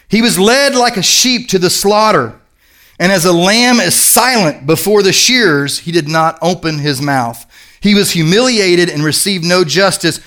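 reading scripture with gravity. Acted. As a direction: read it out as holy text. Reads scripture aloud.

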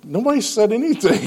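high-pitched acted disbelief; mock indignant protest. Acted protest at being told.

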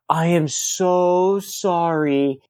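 drawn-out, very slow retold apology; mortified apology. Host apologizes to the headliner he sprayed, drawn-out mortified apology. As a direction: stretch every word, mock mortified.

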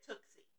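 "Tootsie" with a K, as "Tooksie"; imitated "Tooksie". Man quoting [name]'s daughter, "Tootsie" said as "Tooksie". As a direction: put a K in place of the T in the middle of "Tootsie". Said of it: "And she said".

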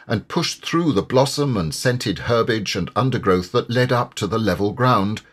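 plain reading voice

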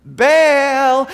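loud cry to a silent god; calling out. Retold or imitated. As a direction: shout the name as a desperate plea. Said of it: "calling out".

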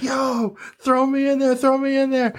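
high-pitched eager begging; eager retold plea in a high voice; excited pleading. Pleading with friend in retelling.

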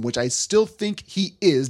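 ordinary talk

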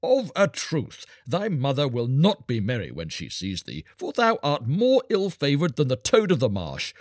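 high, scornful soldier's jeer; mocking cry. A narrator's reading. Soldier drives the beggar from the city gate, loud mockery. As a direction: jeer loftily, stretching out the insults. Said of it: "But they mocked him".

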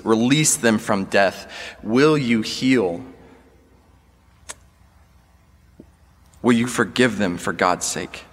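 impassioned preaching appeal. Acted.

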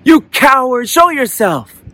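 high, loud challenge; angry shout. A narrator's reading. Boy challenges the unseen voice, shouting angrily. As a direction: yell it out high, daring them to appear. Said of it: "Now he's getting angry".

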